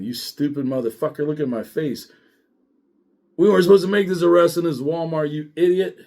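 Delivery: pissed-off rant. Retold or imitated. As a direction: build to a fast, loud telling-off. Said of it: "but I'm pissed".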